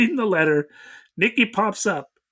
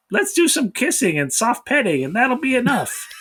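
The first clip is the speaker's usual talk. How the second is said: high-pitched sing-song imitation of a sweetheart; mock lovestruck teen; 7 semitones higher than usual. Mocking a lover proposing kissing before flying home, lovestruck. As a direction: pitch it up as a naive young lover. Voicing the film's lovestruck teenager.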